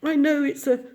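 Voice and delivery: high, tearful, broken voice; weepy confession of a shabby home; sobbing. A narrator's reading. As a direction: choke the words out through tears. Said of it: "he sobbed at last".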